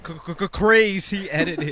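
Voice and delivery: loud stuttered hype with a laugh; mock extreme hype. Joking to co-hosts about extreme editing, mock hype.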